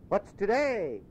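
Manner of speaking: high, eager call down from the window; excited cry. Acted. Scrooge calling down to a boy, excited. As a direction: fling it down to the street, bursting to know. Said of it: "cried Scrooge".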